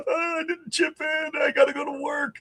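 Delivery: high, strained yell of a coworker left out of the win; mock coworker shout. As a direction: squeal it like the guy stuck at work while everyone's on yachts. Imagined coworker left out of lottery win.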